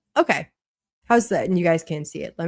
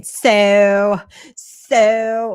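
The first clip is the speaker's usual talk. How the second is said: mock someone's accent, drawing the word out; hammed-up accent imitation, loud and drawn out; Lauren's accent; 2 semitones higher, 9 dB louder than usual. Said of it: "I just sound like Lauren".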